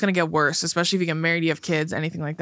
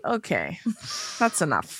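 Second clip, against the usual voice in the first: uncomfortable brush-off; 6 dB quieter than usual. Retold or imitated. Acts out ending an unwanted hug.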